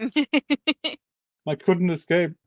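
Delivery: put-on frightened girl. Girl waking from nightmare.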